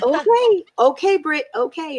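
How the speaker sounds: high, drawn-out okay, humoring Britt; placating okay. Retold or imitated. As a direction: soothe her without believing it. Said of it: "I'm like".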